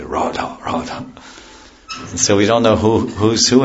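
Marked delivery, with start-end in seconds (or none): 0.0-1.1 whispered, bark-like Radha, Radha; dogs saying Radha. Retold or imitated. Teacher showing the dogs actually chant Radha, breathy and barking. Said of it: "you say".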